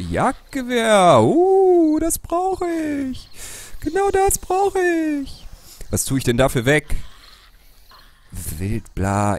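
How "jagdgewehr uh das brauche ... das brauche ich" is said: hohe, langgezogene Begeisterung; aufgeregt. Real, not acted. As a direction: quietschend vor Freude. Findet ersehntes Gewehr. (hoch und gedehnt begeistert)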